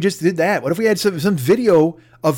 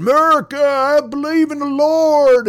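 much higher, louder, slow hammed-up rally cry; mock protester; 11 semitones higher, 4 dB louder, slower than usual. Mocking a maskless protester shouting at a rally, hammed-up. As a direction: lampoon a sign-waving zealot.

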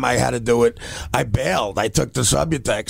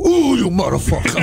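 hammed-up guttural growl at the dumb apprentice; 3 semitones higher than usual. Uncle's boss snarling at a clueless new worker, mock guttural. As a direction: growl it like you want to strangle him. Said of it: "like a guttural".

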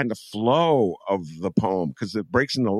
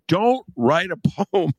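laughing; 10 semitones higher than usual. Retold or imitated. Teacher quote breaks into laugh.

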